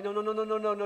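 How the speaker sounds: ordinary talk, nothing marked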